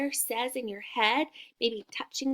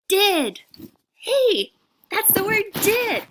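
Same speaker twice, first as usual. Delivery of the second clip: much higher and louder, thrilled; excited praise; 8 semitones higher, 8 dB louder than usual. Shown as a demonstration. Teacher celebrating the class reading the word Did. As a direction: cheer it out like a big win. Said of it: "All together?".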